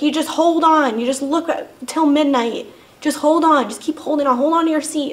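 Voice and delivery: imitating staff, loud pushy coaxing; insistent urging. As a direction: urge it in short, high, emphatic bursts.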